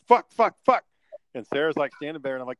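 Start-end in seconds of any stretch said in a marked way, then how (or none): 0.1-0.8 louder, higher burst of mock cussing; retold cursing fit. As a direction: replay a frustrated swearing outburst. Reenacts cussing during truck repair.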